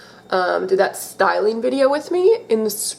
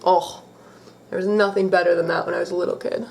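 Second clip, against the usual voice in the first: ordinary talk, nothing marked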